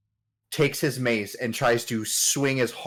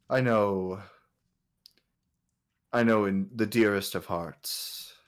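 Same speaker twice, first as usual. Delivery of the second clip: a hint of a musing king, long pauses; understated royal character voice; lightly voiced king at leisure; 4 dB quieter than usual. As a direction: muse like a ruler out strolling. Game master voices the king.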